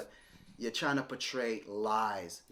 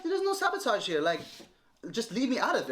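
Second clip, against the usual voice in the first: exasperated; 8 semitones higher, 4 dB louder than usual. Retold or imitated. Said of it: "I'm like".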